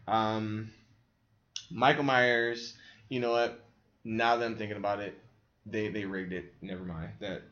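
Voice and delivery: normal talking voice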